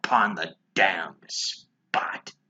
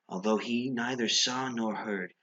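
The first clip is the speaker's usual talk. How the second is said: ordinary delivery, nothing marked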